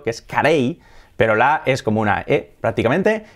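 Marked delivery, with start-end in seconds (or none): 0.2-0.8 "Carey" as ka-REY; Spanish-style pronunciation. Shown as a demonstration. Teacher quoting the surname as Spaniards say it, hispanicized. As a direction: stress the final "ey" of "Carey", tapped R. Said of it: "Qué ocurre con el apellido".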